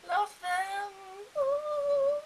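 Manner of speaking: high, loud fangirl squeal; gushing fan. Real, not acted. Harry Potter fan gushing to viewers, high excited squeal. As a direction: swoon over the books out loud.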